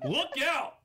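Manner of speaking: high, loud pretend alarm; mock warning shout. Host warning of an incoming villain, mock warning shout.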